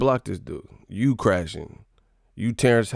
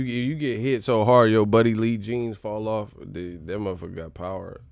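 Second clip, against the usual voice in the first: usual speaking voice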